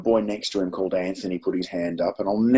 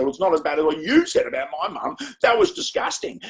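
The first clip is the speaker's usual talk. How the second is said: much higher-pitched mock outrage; indignant retort; 13 semitones higher than usual. Melissa hits back at a classmate over mum jokes, mock indignant. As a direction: imitate an offended schoolgirl firing back. Acted quarrel between two pupils.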